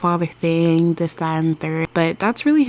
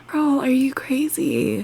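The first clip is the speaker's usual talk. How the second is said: incredulous whisper; 7 semitones higher than usual. Real, not acted. Shocked aside to the sim.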